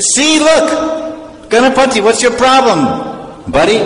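very high, excited I-told-you-so; triumphant pointing-out. Acted. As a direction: show it off in a high, exclaiming voice. Triumphantly showing him the deity.

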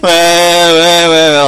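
put-on high, loud baby whimper; crying baby. Baby Krishna fussing at his mother, high loud whimper.

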